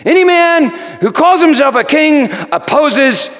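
loud, high threatening cry; crowd crying out. Retold or imitated. The crowd warning Pilate about Caesar, loud cry. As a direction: shout the threat at the governor. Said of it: "they cried out".

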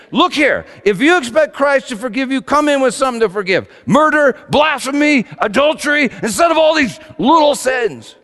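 loud, high exasperated shout; confessor yelling. Retold or imitated. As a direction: bark it at the monk, fed up. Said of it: "his confessor just yelled at him".

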